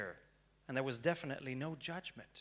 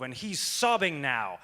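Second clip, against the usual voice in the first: ordinary talk, nothing marked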